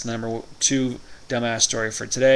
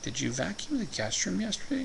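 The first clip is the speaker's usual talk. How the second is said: devilish grin; 8 semitones higher, 7 dB quieter than usual. Retold or imitated. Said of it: "Getting a little devilish grin here".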